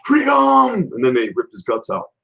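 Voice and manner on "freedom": high, loud battle cry; Mel Gibson freedom yell. Retold or imitated. Quoting the Braveheart ending, a loud high battle scream. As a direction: belt it out like a warrior charging. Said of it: "What did Mel Gibson scream".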